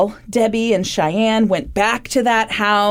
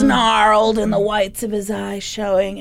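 ordinary delivery

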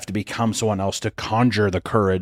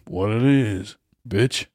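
requested greeting; much slower than usual. Shown as a demonstration. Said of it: "Come on, let's hear it".

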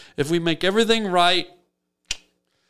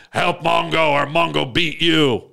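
blunt caveman threat; a brute threatening his buddy; mock-menacing caveman Mongo. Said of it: "he still talks like this".

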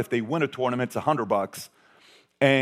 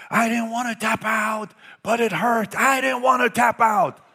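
put-on crying kid; 10 semitones higher than usual. Crying son voiced in story.

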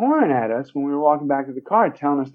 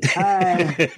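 mock introduction. Mocking own girlish name.

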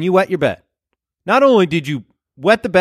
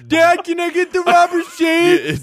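put-on high, slow little kid asking; squeaky child begging dad; 12 semitones higher, slower than usual.

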